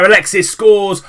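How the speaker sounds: loud, high burst on the goal; excited goal call. Real, not acted. Commentator to viewers as Alexis scores, excited shout.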